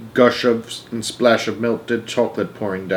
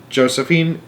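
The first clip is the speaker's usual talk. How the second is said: ordinary delivery, nothing marked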